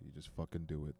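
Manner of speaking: ordinary delivery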